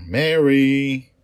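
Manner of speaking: high, loud call across the deck. Acted. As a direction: call out for her friend, searching. Child calls for lost friend.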